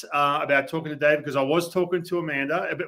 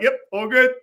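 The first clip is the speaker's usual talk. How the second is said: high, louder, eager-to-comply reply; hasty agreement; 8 semitones higher, 4 dB louder than usual. Retold or imitated. Nervous teammate answering the sister's brother, hasty agreement. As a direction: retell it as a quick, high-pitched yes.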